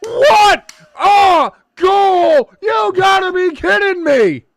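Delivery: high shouting goal call, slow and stretched; goal commentator. Pretend. Calling the US goal to a friend, commentator shout.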